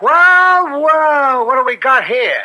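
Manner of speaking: smug, rising well well; gloating. Acted. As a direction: savour the find like a cat with a mouse. Cop finds the drugs.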